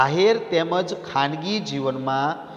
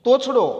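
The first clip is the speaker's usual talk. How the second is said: તોછડો punched high and loud; teacher leans on તોછડો loud and high; stressed word; 9 semitones higher, 7 dB louder than usual. Shown as a demonstration. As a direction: hit તોછડો hard and high, falling.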